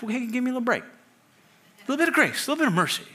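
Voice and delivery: high-pitched mock pleading; pleading for leniency. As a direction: wheedle for mercy in a high little voice. Acting out pleading for oneself.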